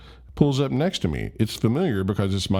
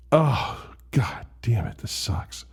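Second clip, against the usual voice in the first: groan, then curse under your breath; exasperated groan; 3 semitones higher, slower than usual. Retold or imitated. Embarrassed son in the car, whispered dismay.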